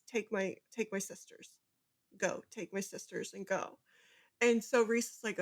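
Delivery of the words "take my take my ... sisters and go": much softer, hushed urging; secret urging. Retold or imitated. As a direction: tell him quietly, in secret. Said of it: "secretly telling Rhys".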